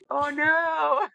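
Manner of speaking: high, sharp "oh no" of sudden dismay; alarmed gasp. Retold or imitated. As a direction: yelp it out as it hits you. Realizing she forgot her arrows.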